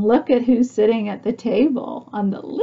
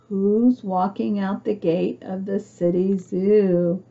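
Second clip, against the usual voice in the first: ordinary delivery, nothing marked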